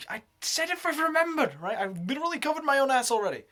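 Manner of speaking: high, loud, fast defensive protest; mock defensive self. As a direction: rattle it off high, loud and indignant. Quoting herself defensively.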